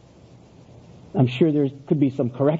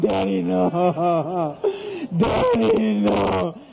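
put-on drawn-out sobbing child's wail; mock wailing; 2 semitones higher, much slower than usual. Son crawling away from his father, mock wailing. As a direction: wail for Daddy in hammed-up tears.

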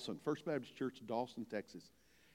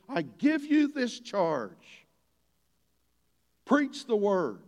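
raised, forceful command, drawn out; loud, high preacher's charge; emphatic charge; 5 semitones higher, 7 dB louder, much slower than usual. Acted. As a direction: deliver it as a solemn, urgent order. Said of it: "it is with that kind of emphasis".

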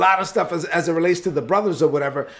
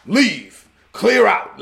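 lightly acted ruler's command, louder; mock-stern order, each command on its own; commanding Sultan; 2 semitones higher, 4 dB louder, much slower than usual. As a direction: dismiss the room like a Sultan in charge. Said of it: "he maintained his character for one last statement".

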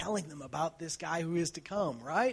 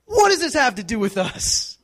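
high, loud call of one word; sharp address; 8 semitones higher, 15 dB louder than usual. Retold or imitated. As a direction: snap WOMAN out firmly at his mother. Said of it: "But he goes".

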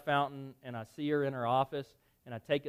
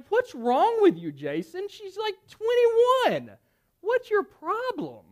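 high, loud, drawn-out rant; exasperated self-scolding; 6 dB louder, slower than usual. Retold or imitated. Man berating himself over his grudge, high exasperated rant. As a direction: scold yourself in a high, incredulous voice. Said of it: "kinda stare her down".

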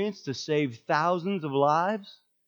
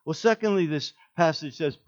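normal talking voice

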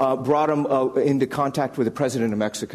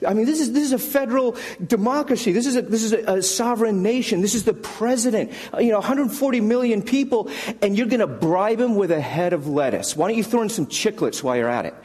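higher, incredulous tirade; berserk rant; 7 semitones higher than usual. Retold or imitated. Colleague ranting at Cam about the lettuce, higher and incredulous. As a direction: rant at him in disbelief. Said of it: "his friend goes berserk on him".

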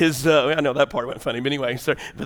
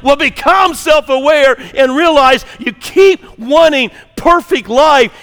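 raised, driving preacher voice, higher pitched; forceful preaching; 7 semitones higher, 7 dB louder than usual. Real, not acted. Pastor confronting congregation about chasing a perfect life, forceful preaching. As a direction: press each phrase home with rising intensity.

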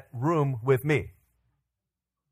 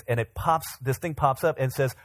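normal talking voice

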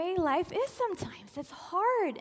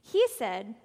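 ordinary talk, nothing marked

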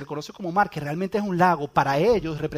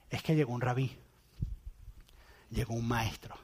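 hushed awe; 6 semitones lower, 8 dB quieter than usual. Pretend. Zebedeo explaining to wife, hushed.

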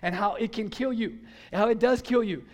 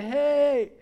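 loud, higher-pitched call upstairs; 5 semitones higher, 5 dB louder than usual. Retold or imitated. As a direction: call a sister down to the kitchen. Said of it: "I called her down".

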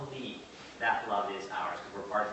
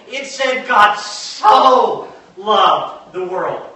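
"so" held long and very loud; slow right down and belt out "so"; stress on "so"; 10 semitones higher, 18 dB louder, much slower than usual. Shown as a demonstration. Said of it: "That's a significant word".

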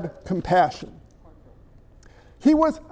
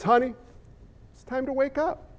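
tender wake-up; 9 dB quieter than usual. Acted. Jesus voiced waking the girl.

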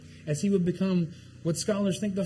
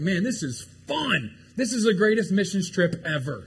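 playfully hyped-up enthusiasm; thrilled disciples; 3 semitones higher, 5 dB louder than usual. Acted. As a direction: act out disciples having a blast. Voicing disciples thrilled by the trip.

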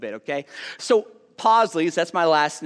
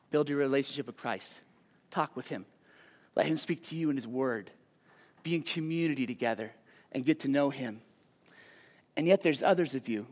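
softer, gentle pastoral plea; tender appeal; 4 semitones lower, 7 dB quieter than usual. Acted. Preacher to struggling teens, softer and gentle. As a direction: lean in and comfort the hurting kids.

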